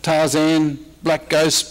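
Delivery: usual speaking voice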